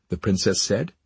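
ordinary delivery

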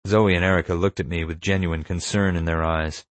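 ordinary delivery, nothing marked

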